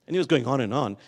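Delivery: normal talking voice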